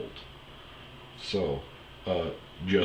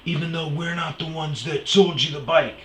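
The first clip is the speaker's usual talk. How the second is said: mock employee protest; 9 semitones higher, 5 dB louder than usual. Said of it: "And he goes".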